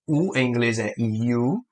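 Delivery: "U" said as English "you", slowly; name the letter "U" like "you". Shown as a demonstration. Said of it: "que é U". (diga "U" como "iú", devagar)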